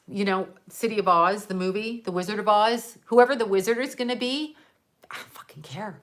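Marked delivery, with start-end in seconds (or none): 5.1-6.0 much softer, whispered; a hushed, defiant whisper; whispered aside. Real, not acted. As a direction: drop to a whisper and mean it. Ranting about who rules Oz.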